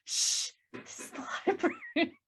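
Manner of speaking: hushed shush, high and soft with a laugh; librarian shushing whisper. Acted. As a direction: shush him like a strict librarian. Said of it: "Nick goes up to him and says".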